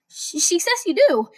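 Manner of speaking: high, hesitant voice; nervous Deku. Acted.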